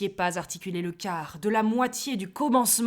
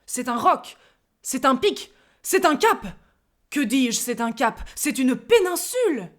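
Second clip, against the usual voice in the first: building louder and higher, grander each time; descriptive; 4 semitones higher, 7 dB louder than usual. Acted. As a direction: pile up the images with growing pomp. (description qui s'emballe)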